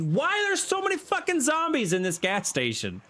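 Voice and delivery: high, incredulous outburst; frustrated cry about zombies in the gas station; exasperated outburst. Real, not acted. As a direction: yelp at the horde in disbelief.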